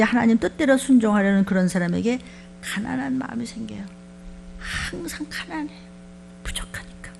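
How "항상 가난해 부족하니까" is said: hushed whisper. Acted. Preacher lowers voice for humility.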